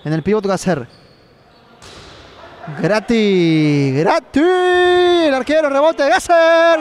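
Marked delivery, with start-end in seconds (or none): 2.8-6.8 rising sports commentary build; live play-by-play. Acted. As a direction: call the play as it unfolds.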